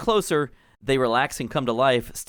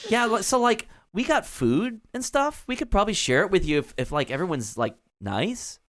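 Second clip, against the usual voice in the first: put-on valley girl gnome; 8 semitones higher than usual. GM voices gnome Clotilda.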